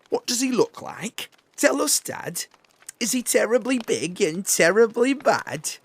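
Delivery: put-on high, loud excited child voice; the wide-eyed kid begging for the story; eager little child. Gruffalo's child begging her dad for details.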